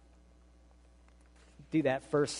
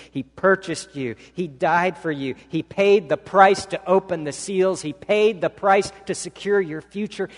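louder, rising preacher's proclamation; preaching at full force; 5 semitones higher, 6 dB louder than usual. Acted. Preacher proclaiming to the congregation, rising full-force preaching. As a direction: build and proclaim it.

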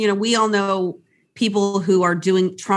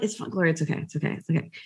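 reassuring friends; 7 semitones lower, 7 dB quieter, much faster than usual. Retold or imitated. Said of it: "they're like".

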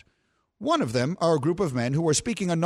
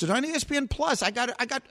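ordinary delivery

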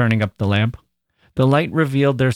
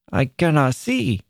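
put-on higher, sulky child's whine; sulky complaint; 4 semitones higher than usual. A narrator's reading. Boy repeating his complaint to mother, sulky. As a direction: complain sulkily, swooping down on "see". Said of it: "complaining sulkily".

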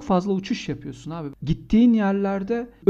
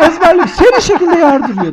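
higher, louder, laughing through the words; can't stop laughing while telling it; 11 semitones higher, 12 dB louder than usual. Real, not acted.

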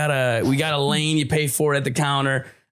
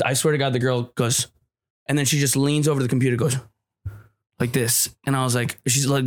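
ordinary talk

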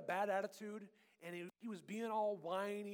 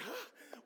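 sharp high shocked gasp; hammed-up parental gasp; mock gasp; 9 semitones higher, 6 dB quieter than usual. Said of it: "Mom and Dad are like".

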